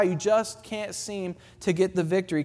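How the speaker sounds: ordinary delivery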